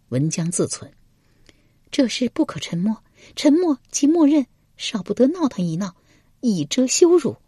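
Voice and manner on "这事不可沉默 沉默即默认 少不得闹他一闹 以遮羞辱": high female voice thinking to herself; high-pitched inner monologue; noblewoman scheming. Acted. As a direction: plot privately in a high lady's voice.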